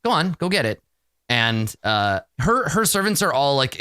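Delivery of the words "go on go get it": higher, brisk order; commanding mistress. Acted. Mistress ordering her servant to fetch, higher-pitched command. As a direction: send a servant off with a raised pitch. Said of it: "turns to one of her servants and is like".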